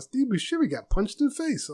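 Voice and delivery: high-pitched retold mom; imitating mom. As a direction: mimic mom passing on startling news. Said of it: "My mom would call me".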